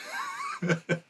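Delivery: squealed burst of laughter; high laugh. Real, not acted. Reviewing the movie, high-pitched laugh. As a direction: crack up at the scene.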